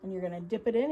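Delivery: ordinary delivery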